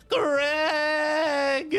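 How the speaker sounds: high, loud sobbing shout of Greg; a heartbroken tiefling wails; wailing cry. Acted. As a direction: break into tears on the name. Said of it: "as he bursts into tears".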